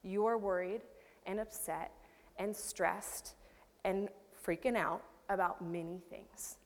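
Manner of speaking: hushed, unvoiced, tender words; tender whisper. Preacher voicing Jesus softly to her listeners, tender whisper. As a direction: breathe it softly like a comfort. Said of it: "Jesus is saying to you".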